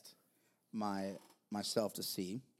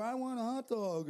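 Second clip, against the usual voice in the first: imitated kid; 12 semitones higher than usual. Dad voicing kids' dinner requests.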